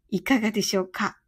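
usual speaking voice

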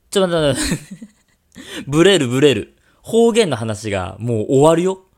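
loud, laughing flustered objection; flustered protest. Real, not acted. Host reacts to a surprise Costa Rica birthplace, flustered laughing protest. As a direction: blurt it out loudly, thrown by the surprise.

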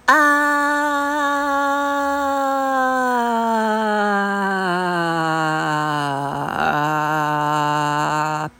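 deep low long ah; low sustained ah. Shown as a demonstration. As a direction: hold a long low note. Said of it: "いきますよ。せーの。". (低く長く伸ばすあー)

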